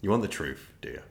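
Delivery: grave confession. Retold or imitated. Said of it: "I was like".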